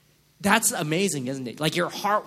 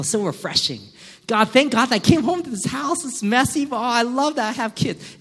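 higher, louder, hammed-up gushing; gushing gratitude; 6 semitones higher, 4 dB louder than usual. A worker mockingly gushing thanks to God after coming home. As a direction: overdo the rapturous thank-yous.

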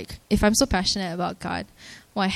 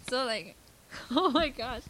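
breathy laughter, softer, higher; emotional laugh; 5 semitones higher, 8 dB quieter than usual. Real, not acted. Thanking friends who came, choked with emotional laughter. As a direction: laugh it off, overcome.

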